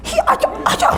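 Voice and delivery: high, whispered sputtering protest; a sibling choking on the unfairness; sputtering kid. Acted. Other kid protesting to the mother.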